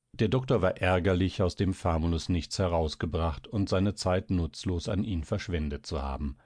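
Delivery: plain reading voice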